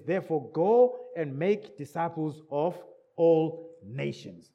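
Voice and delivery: ordinary talk, nothing marked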